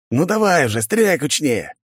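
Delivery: high-pitched, impatient egging-on; urging on. Acted. Guard urges his mate firing at pigeon, urging. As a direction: spur the shooter on.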